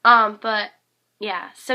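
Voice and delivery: ordinary talk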